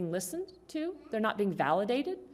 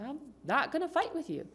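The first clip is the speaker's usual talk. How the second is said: high, gentle refusal; calm refusal; 8 semitones higher, 3 dB quieter than usual. Acted. Wife to shouting husband, calmly not gonna fight. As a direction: decline the fight unruffled.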